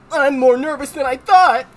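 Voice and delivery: high, loud, jittery voice; anxious nerd admitting nerves; shaky nerd. Acted. Rookie fretting on his first job, shaky. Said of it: "he's kinda like a shaky nerd".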